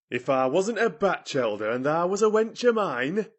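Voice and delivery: put-on indignant old gardener threatening; indignant cry. A narrator's reading. Ben shaking his fist at Mary, indignant cry. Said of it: "he cried".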